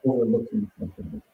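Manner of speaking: muffled, far from the mic; underwater. Real, not acted. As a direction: sounds like talking underwater. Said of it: "You sound like you're underwater".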